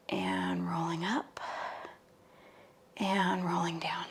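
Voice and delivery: slow soft whisper cue. Shown as a demonstration.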